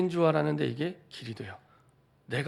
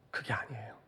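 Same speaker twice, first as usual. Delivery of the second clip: hushed, confiding correction; a sudden whisper to draw the listeners in; 5 semitones lower, 9 dB quieter than usual. Real, not acted. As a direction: drop to a whisper for the turn. Preacher drops to a whisper.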